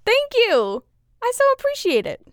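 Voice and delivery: bright, high, grateful gush; gushing high thanks. Real, not acted.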